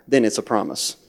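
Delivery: ordinary talk, nothing marked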